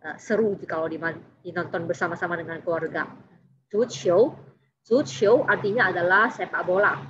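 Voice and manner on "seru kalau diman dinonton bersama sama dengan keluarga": ordinary delivery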